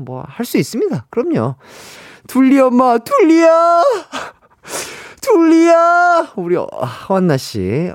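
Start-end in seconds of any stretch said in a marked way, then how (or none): 2.3-3.8 put-on high loud calling out for a child. Calling out like Dooly's mom. 5.2-6.3 crying call. Pretend.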